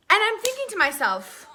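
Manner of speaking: ordinary delivery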